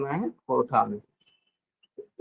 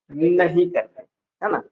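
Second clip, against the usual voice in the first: normal talking voice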